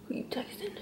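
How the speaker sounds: nosy hushed whisper; whispered snooping question. Acted.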